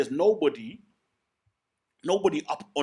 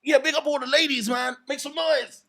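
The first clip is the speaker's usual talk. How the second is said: high, loud DJ hype in a put-on accent; 6 dB louder than usual. Fake DJ shouting out the ladies on stage, put-on hype accent. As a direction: hype the crowd like a DJ, pitched way up. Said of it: "speaking in that accent".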